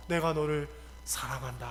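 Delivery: low, soft, hushed declaration; tender. Acted. The Lord comforting a dreamer, tender and low.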